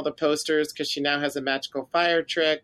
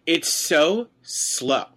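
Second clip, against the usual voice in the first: slow, elongated words; dragged-out complaint; much slower than usual. Shown as a demonstration. Podcaster complaining to co-host about the scene, dragged out. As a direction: stretch so and slow way out.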